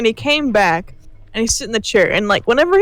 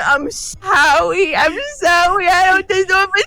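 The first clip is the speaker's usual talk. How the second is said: slow, higher, teary imitated sobbing; mock sobbing apology; 7 semitones higher, slower than usual. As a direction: imitate a friend bawling over a broken chair. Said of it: "having, like, a mental breakdown".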